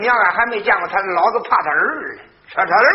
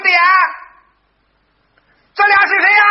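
demanding shout; 9 semitones higher, 6 dB louder than usual. Acted. Boy demands who guests are.

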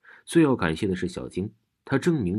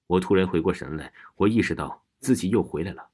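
ordinary delivery, nothing marked

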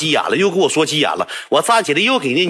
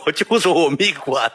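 usual speaking voice

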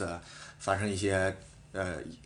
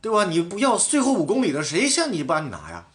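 higher, louder heated retort; retold argument; 6 semitones higher, 3 dB louder than usual. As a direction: argue back with mild heat. (复述在车上与队友争执，语气激动)

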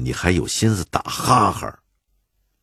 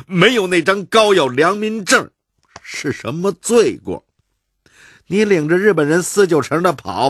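high, loud squabbling Beijing voices; raised, sparring neighbourhood argument; heated street quarrel; 13 semitones higher, 5 dB louder than usual. Acted. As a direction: bicker back and forth, pitched up.

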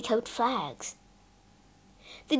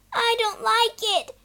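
much higher, frightened cry; shrieking; 11 semitones higher than usual. Acted. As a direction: shriek in fear. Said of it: "shrieked Phyllis".

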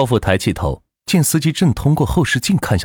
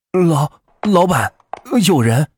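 higher, halting driver's alarm; startled stammer; 6 semitones higher, slower than usual. Acted. Driver warning his passenger after braking hard, startled. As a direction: stumble over the words in mild panic.

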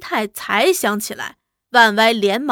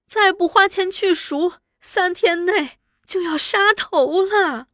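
high-pitched weeping appeal; tearful pleading; 5 semitones higher than usual. A narrator's reading. Wife begs husband to save her brother, tearful pleading. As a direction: beg through tears in a raised, wailing voice. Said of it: "只是哭".

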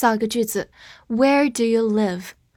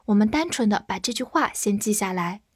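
normal talking voice

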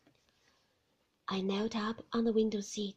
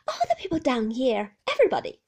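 put-on anxious young wife; 2 semitones higher, 11 dB louder, faster than usual. Heroine complaining.

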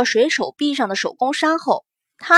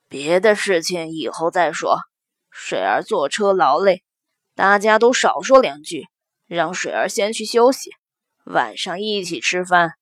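put-on lower, firm elderly lady; old matriarch voice; 6 semitones lower than usual. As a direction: grandmother closing the discussion calmly. Matriarch settles the family.

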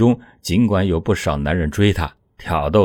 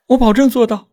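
high, louder woman's voice, firm promise; desperate young wife; 6 dB louder than usual. A narrator's reading. Wife vows to husband, high and loud. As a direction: promise it with everything.

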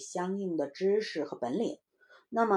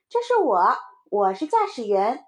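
put-on loud child claiming the pilot's role; proud little child; 3 semitones higher, 7 dB louder than usual. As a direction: announce it loudly as a kid. Child assigns himself the pilot. (小孩得意地大声宣布)

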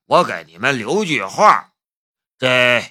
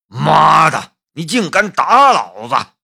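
loud, high enraged yell; furious roar; 4 semitones higher, 6 dB louder than usual. A narrator's reading. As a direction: roar it as if struck. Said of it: "陈传虎暴跳如雷".

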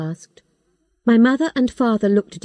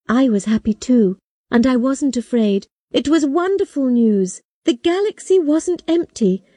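plain reading voice

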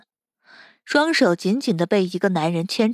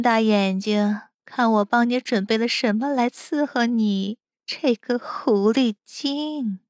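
slow, drawn-out sneer; mocking taunt; 2 semitones higher, slower than usual. Captor mocks the helpless captive, slow drawn-out taunting. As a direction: stretch the words to taunt.

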